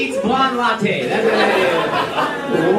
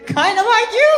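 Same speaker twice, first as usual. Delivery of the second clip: imitating the husband; 11 semitones higher, 4 dB louder than usual. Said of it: "he said".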